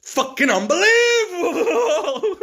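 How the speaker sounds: imitated ecstatic shriek, drawn out slowly; top-of-lungs scream. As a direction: scream it very high in total disbelief, tipping into laughter. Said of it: "he's screaming from the top of his lungs".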